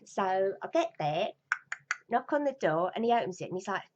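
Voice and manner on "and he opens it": plain reading voice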